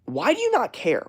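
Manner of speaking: high-pitched incredulous challenge; acted exasperation. Bothered man confronting a forgetful friend, exasperated. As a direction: demand an answer, exasperated.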